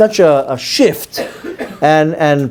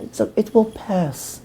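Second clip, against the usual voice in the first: soft, slow, dismissive murmur; dismissive quote; 4 semitones higher, 10 dB quieter, slower than usual. Retold or imitated. Quoting people shrugging off the riots, soft slow dismissal. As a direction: brush it off lazily. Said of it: "what they're saying".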